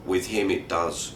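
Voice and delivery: ordinary delivery, nothing marked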